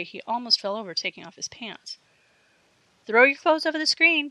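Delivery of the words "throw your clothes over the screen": high, loud demand. A narrator's reading. Karen orders Jerry to hand over clothes, loud demand. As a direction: order it loudly. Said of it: "demanded Karen".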